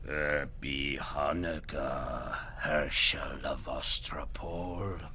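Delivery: slow, low, eerie whispered greeting; menace, drawn out slow; creepy goblin king. Acted. Goblin King greets Herschel in the dark, menacing. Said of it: "sounded like the cracking of bones".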